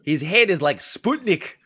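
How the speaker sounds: hammed-up Scottish teasing; Mike Myers. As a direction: poke fun in a broad Scottish voice. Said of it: "the Mike Myers Scottish".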